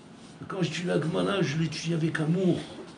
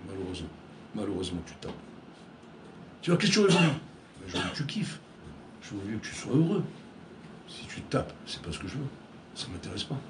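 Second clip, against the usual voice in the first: soft, low, sorrowful and gentle; quietly disappointed father; sad tender reply; 6 semitones lower, 6 dB quieter, faster than usual. Acted. God gently answering his son, soft and sorrowful.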